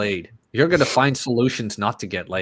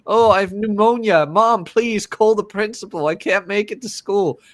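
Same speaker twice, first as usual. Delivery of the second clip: put-on faking sick kid; 6 semitones higher than usual. Kid faking illness to mom, mock sick whine. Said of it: "shit we'd pull to get out of school".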